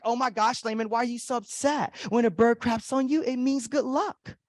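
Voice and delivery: put-on girl's voice lifting at the end; imitated girl. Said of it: "there was this girl, she's like".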